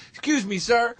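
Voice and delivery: over-polite request. Retold or imitated. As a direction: ask a stranger like a lost, courteous traveler. Acts Patrice asking a driver directions.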